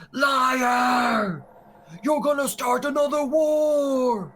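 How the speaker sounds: high, loud accusing shriek; drawn-out, high-pitched protest shout; screaming heckler. Acted. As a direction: yell it over the speech from the crowd. Crowd heckler interrupts speech.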